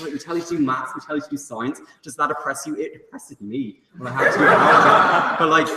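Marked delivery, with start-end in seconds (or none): 2.7-5.7 words breaking into laughter, a bit slower; laughing. Real, not acted. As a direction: laugh your way through the complaint. Laughing through a complaint.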